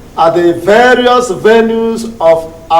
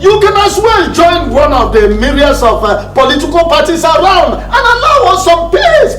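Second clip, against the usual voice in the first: raised, higher-pitched scolding; angry dismissal; 6 semitones higher than usual. Acted. Chairman dismissing Desmond's objection, angry raised voice. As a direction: show the dissenter the door, voice raised.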